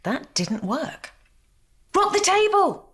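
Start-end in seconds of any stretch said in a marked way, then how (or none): 1.9-2.9 high, loud call; shouted. A narrator's reading. Twin guests call out a plan, shouting. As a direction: shout the idea out. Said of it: "shouted the twin guests".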